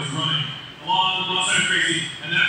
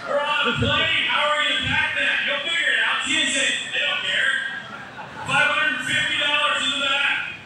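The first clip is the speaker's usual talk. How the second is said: auctioneer patter; 5 semitones higher than usual. Acted. Charity auction selling blanket.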